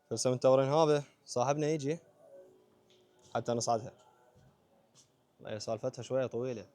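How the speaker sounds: normal talking voice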